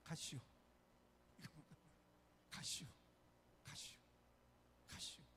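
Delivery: 가시오 said short and quick, Chungcheong style; Chungcheong dialect. Retold or imitated. Lightly imitated Chungcheong dialect. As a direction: pastor jokes Chungcheong folk die fast. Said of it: "빨라".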